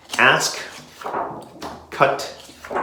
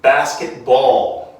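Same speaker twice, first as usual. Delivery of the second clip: slow, careful basketball. with each syllable drawn out; slow model word; 5 dB louder, much slower than usual. Shown as a demonstration. Teacher modelling the word slowly for the class to repeat. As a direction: stretch basketball. out for learners to copy. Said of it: "Everybody say".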